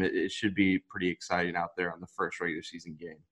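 normal talking voice

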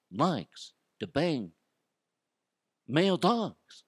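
slow, drawn-out mocking voice with a shifted timbre; a lightly hammed-up mock voice; put-on silly voice. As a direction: play a goofy character asking why anyone cares. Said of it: "And I like to talk like this".

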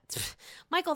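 normal talking voice

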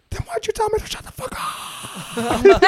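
very high, strained mock scream; exploding. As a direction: blow up at the cop in a squeal. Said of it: "And then I exploded".

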